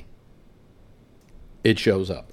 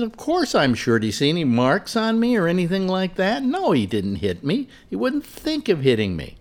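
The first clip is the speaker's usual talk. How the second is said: imitating Lori; 7 semitones higher than usual. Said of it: "And she said".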